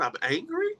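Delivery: high, soft disbelieving rise; can you believe this; incredulous question. Real, not acted. Rebutting the angry label.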